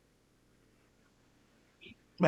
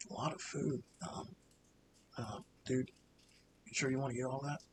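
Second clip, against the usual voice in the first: much softer, lower, murmured aside to a friend; low quiet voice; 4 semitones lower, 14 dB quieter than usual. Acted. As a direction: mutter it under your breath. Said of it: "the voice say".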